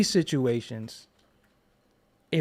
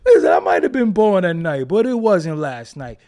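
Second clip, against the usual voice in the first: louder, pressing the point hard; emphatic loud declaration; 8 dB louder than usual. Real, not acted. Host declaring to his audience, loud and emphatic. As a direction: hammer home that he's nobody's fool.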